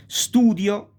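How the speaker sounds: Studio called out high and loud; teacher presenting an impure S word; modelled word. Shown as a demonstration. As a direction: announce Studio brightly as an example. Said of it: "la S impura".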